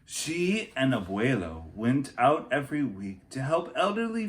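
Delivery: put-on lively read-aloud for children; picture book reading. A narrator's reading. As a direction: tell the story to a class.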